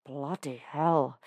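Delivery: growl. A narrator's reading. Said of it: "he growls".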